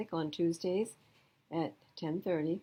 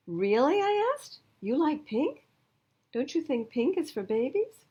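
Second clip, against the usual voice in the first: high, loud amazed question; surprised little girl; 9 semitones higher, 6 dB louder than usual. Acted. Girl amazed classmate likes pink, high and loud. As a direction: gasp it out in disbelief.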